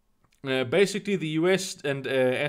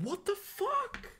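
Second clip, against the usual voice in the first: incredulous squeak; 9 dB quieter, slower than usual. Real, not acted. Exasperated at news.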